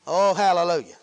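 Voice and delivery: ordinary talk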